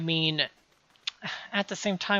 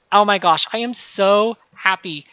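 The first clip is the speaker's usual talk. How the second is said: excited; 4 semitones higher, 8 dB louder than usual. Real, not acted. Excited about new content.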